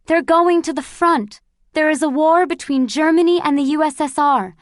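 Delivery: joyful shout. Acted. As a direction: shout the news to the whole car. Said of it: "she shouted".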